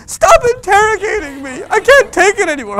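hammed-up, high, loud teenage shriek; losing it. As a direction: overblown freak-out at a harmless question. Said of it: "stop losing it".

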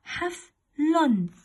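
"حفل" very slowly, leaning on the middle faa; مد الفاء. Shown as a demonstration. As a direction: drag "حفل" out to make the faa clear. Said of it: "يوضع الفاء في وسط الكلمة". (نطق "حفل" ببطء شديد مع إبراز الفاء)

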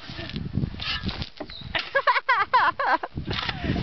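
long, high burst of laughter; high laughing. Real, not acted. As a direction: crack up in a high cackle. Laughing while filming each other.

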